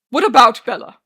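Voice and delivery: very loud commanding question; booming, dark demand. A narrator's reading. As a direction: boom it out from the depths of the lungs. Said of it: "Daniel's voice is booming and dark".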